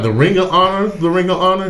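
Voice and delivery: high, repeated incredulous question; can't believe what he's hearing; stunned disbelief. Retold or imitated. Retelling the call to Tim, high disbelieving question.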